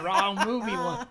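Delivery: loud, high, laughing echo; mock catchphrase. Repeating the spoofed line to friends, loud and laughing. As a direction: repeat it loudly through laughter. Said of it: "He said".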